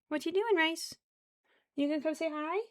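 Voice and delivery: high cooing voice to a pet. Real, not acted. Cooing high to her pet rice cake.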